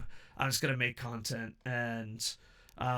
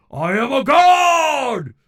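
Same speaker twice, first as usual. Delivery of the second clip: slow, booming, much louder and higher; god voice; 11 semitones higher, 15 dB louder, much slower than usual. Pretend. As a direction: proclaim it like a deity. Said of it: "Did I sound like a god?".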